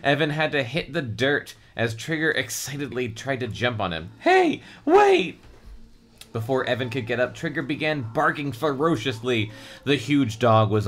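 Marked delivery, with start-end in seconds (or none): 4.2-5.4 high, loud call after the dog; urgent call. Acted. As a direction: shout it after him. Kids chasing runaway dog.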